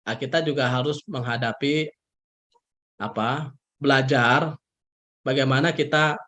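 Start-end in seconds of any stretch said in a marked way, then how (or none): none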